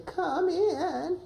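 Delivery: very high, quavery granny answer; high-pitched grandma voice. Shown as a demonstration. Coach prompting grandma to call come in. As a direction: squeak it up high like a weak old grandma. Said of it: "Grandma, can you say".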